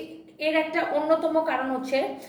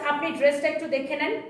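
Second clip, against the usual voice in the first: usual speaking voice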